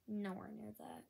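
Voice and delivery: soft whispered aside. Real, not acted. Kid tells Mikey his show is not Heroes, whispered. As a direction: drop to a whisper, almost to yourself.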